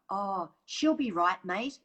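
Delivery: slow, hearty Aussie reassurance, dismissive; Australian brush-off. Shown as a demonstration. Facilitator demos the Aussie brush-off in an Australian accent. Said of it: "one example that's very Australian".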